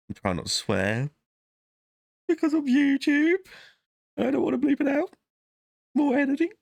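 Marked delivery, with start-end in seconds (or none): 2.3-6.5 very high squeaky complaining. Pretend. Vlogger griping about bleeping swears, squeaky high. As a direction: whine it in a comic squeak.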